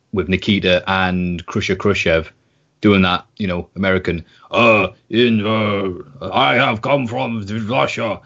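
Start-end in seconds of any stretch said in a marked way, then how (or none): 4.5-8.2 slow, put-on Russian accent, stretched out; mock Russian accent. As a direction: ham up a cartoon Russian wrestler's accent.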